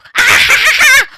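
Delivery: high villain cackle; evil laugh. Acted. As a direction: cackle like a gleeful killer. Killer gloats over a kill.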